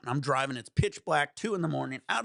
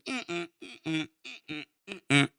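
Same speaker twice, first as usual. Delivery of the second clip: imitated back-and-forth car; 4 semitones higher, faster than usual. Storyteller imitates the driver's endless U-turn, stuttering little noises. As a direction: mimic tiny stop-start car manoeuvres. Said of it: "trying to make a fucking U-ey like Austin Powers".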